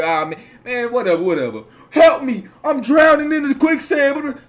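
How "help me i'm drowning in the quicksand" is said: high, loud mocking plea for help; mock cry for help. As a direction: whine it like a drowning man begging, loudly. Mocking drowning friend's plea.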